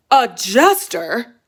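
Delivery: shocked king. Acted. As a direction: draw it out in disbelief.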